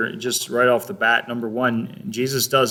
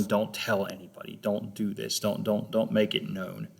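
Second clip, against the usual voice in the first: hushed warning; 10 dB quieter than usual. Retold or imitated. Jesus warning the parents and disciples, hushed. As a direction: keep it quiet, like a secret order. Jesus warns them to keep secret.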